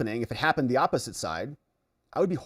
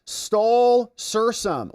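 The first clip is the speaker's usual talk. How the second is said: drawn-out greeting; 9 semitones higher, 5 dB louder, much slower than usual. Real, not acted. Streamer calling out a new member's name, slow and loud. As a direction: stretch the name out slowly, higher and louder.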